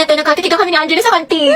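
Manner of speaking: usual speaking voice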